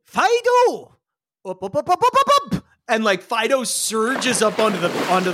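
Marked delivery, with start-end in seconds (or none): 0.0-1.0 calling the pet. Acted. Eel summoning Fido, high called shout. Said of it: "Eel goes". 1.4-2.6 urging the pet. Acted. Eel urging Fido to surge up, rising chant. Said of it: "Eel goes".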